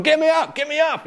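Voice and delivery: very high, loud whimpering plea; panicked plea. Retold or imitated. Starfish climber clinging to rock, high panicked plea. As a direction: squeal it like someone clinging to the rock. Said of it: "just going".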